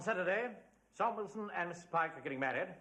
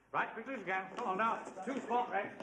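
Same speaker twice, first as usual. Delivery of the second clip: ordinary talk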